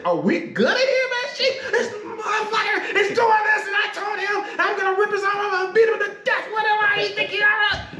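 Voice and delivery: hammed-up raging chief; mock enraged rant. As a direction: chief shouting to the captain about the sailor, mock rage. Enraged chief rants to captain.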